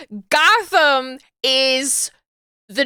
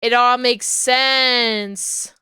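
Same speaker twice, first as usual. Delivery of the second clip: slow, drawn-out mock epiphany; hammed-up "aha" moment, words dragged out; dawning realization; much slower than usual. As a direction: stretch every word as it all clicks. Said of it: "you're like".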